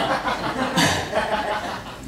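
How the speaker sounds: a soft, airy chuckle; breathy laugh. Real, not acted. Joking to the congregation, a soft airy laugh. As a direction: laugh quietly at your own joke. Laughs at his own joke.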